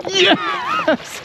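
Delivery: very high burst of triumph; excited yes. Real, not acted. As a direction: yell it like you just won. Lands a 25 inch striper.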